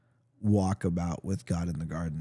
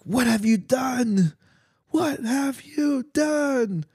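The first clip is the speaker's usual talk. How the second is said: higher, slow, drawn-out cry of dismay; dramatic outcry; much slower than usual. Acted. God crying out to the woman after she ate, high and slow. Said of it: "Like that sort of thing".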